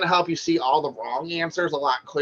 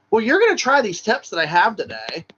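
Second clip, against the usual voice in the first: mock directive, higher-pitched; telling someone what to do; 6 semitones higher, 4 dB louder than usual. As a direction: lay down the instruction as if no choice. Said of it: "different than telling them what to do".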